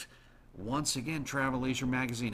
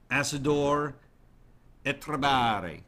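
careful, louder Italian-sounding reading of the Spanish name; Italian-ish accent; 5 dB louder than usual. Shown as a demonstration. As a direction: roll it out like an Italian. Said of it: "I kind of sounded Italian doing that".

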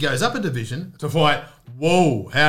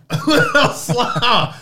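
SLOWER stretched out high, through laughter; drawn-out slower; 8 semitones higher, 4 dB louder than usual. Acted. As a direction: drag out the word slower for comic effect. Repeats it slower as a joke.

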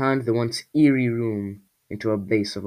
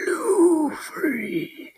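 high strained cry of a name; hoarse creature voice; 5 dB louder than usual. A narrator's reading. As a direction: rasp it out like a cave creature. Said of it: "in a hoarse voice he had".

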